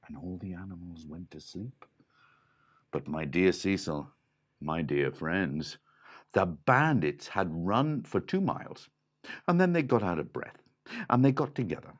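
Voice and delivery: plain reading voice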